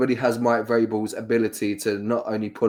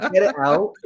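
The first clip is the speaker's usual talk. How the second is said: laughing, higher mock command; mock outrage; 6 semitones higher, 4 dB louder than usual. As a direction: playfully shoo the words away.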